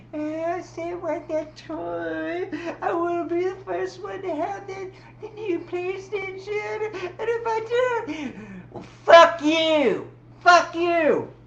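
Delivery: high, slow, whiny brat voice ending in loud cursing; Cartman. Retold or imitated. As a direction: whine like a spoiled kid then explode. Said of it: "if you don't give Cartman exactly what he wants".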